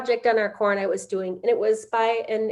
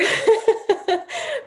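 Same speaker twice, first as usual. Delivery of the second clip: teary laugh; 12 semitones higher, 3 dB louder than usual. Real, not acted. Apologising for tears, laughs.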